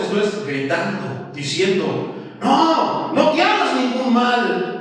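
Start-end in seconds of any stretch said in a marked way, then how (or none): 2.4-4.8 high, urgent call to stop; Paul crying out. A narrator's reading. Paul cries out to the jailer to stop him. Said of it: "clamó a gran voz".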